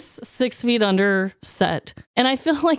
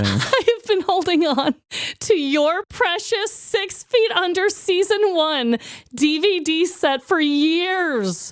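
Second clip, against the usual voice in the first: dramatic confession; 7 semitones higher than usual. Acted. Guilty confession, high dramatic.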